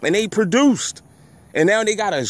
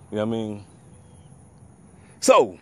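ordinary talk, nothing marked

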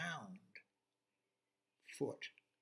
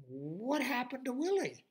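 baffled farmer; 10 semitones higher, 5 dB louder than usual. Acted.

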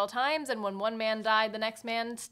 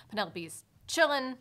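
ordinary delivery

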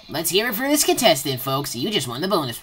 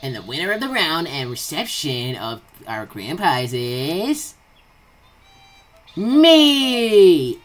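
booming prize announcer; 5 dB louder, slower than usual. Acted. Announcing self as winner.

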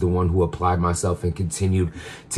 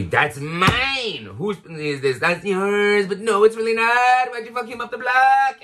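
possessive jealous man; 11 semitones higher than usual. Retold or imitated. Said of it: "Men are very".

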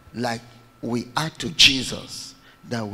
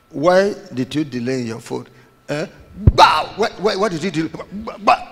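loud, raised pitch, barking repeated why; hammed-up furious husband; mock angry husband; 3 semitones higher, 7 dB louder than usual. Preacher acts a worldly husband scolding his wife, loud and angry.

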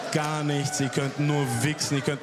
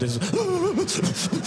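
mock snotty sob; much slower than usual. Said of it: "wenn Rotz rein und rauskommt".

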